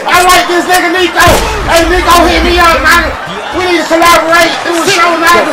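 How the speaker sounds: loud, high hype for Nico; hyped shouting. Real, not acted. Streamer hyping Chef Nico to collaborate, loud excited shouting. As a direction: shout your praise like a superfan.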